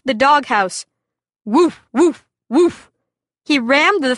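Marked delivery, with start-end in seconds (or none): 1.4-2.9 imitating a dog's woof; dog barking. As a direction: bark like a lively dog.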